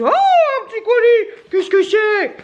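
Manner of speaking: high, loud, drawn-out delight; hammed-up gasp of discovery; mock surprise. Acting out opening the mailbox.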